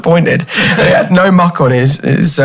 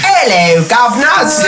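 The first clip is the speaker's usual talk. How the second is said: chirpy Cockney greeting; Cockney accent; 5 semitones higher, slower than usual. Pretend. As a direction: doff your cap, Cockney. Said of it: "channel a, um, Victorian, uh, chimney sweep".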